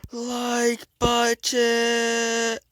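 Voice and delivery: high, drawn-out shout; hammed-up soldier yell; loud gung-ho soldier. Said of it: "So he goes".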